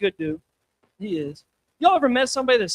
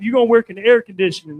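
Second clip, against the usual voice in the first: put-on loud, stern old-woman order; big mean grandma; 7 dB louder than usual. Grandma telling her grandkid about work, loud and bossy. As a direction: bark it like a tough grandma. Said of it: "That was her real voice".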